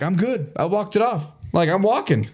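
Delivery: high-pitched, insistent all-clear; retold insistence. Fallen rider to worried friends, high insistent reassurance. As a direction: re-enact brushing off a fall, pitched high. Insists he is unhurt after crash.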